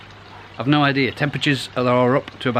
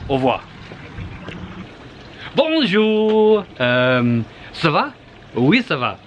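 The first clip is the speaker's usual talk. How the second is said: mock French greeting; 5 semitones higher, much slower than usual. Vlogger back from France, hammed-up French accent. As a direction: play the tourist showing off schoolboy French. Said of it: "I'm fluent in French now".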